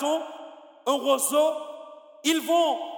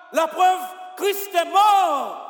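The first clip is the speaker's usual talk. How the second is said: louder, higher and slow; emphatic preaching; 5 semitones higher, 7 dB louder, slower than usual. Acted. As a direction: lean hard on every word.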